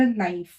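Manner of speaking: "नाइफ" with an "ai" glide; mistaken reading. Shown as a demonstration. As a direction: say "नाइफ" like English knife. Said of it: "sorry, नाइफ नहीं". ("नाइफ" में आइ की ध्वनि)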